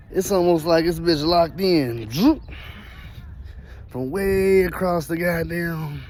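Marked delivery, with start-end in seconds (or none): 2.1-2.4 quick high-pitched "zoop"; imitated zoop. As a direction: make a little zip sound, pitched up. Imitates locking in with noise.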